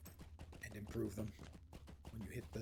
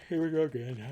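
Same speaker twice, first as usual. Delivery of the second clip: talking through a yawn; words stretched by a big yawn; 6 semitones higher, 12 dB louder than usual. Real, not acted. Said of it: "Pardon the yawn".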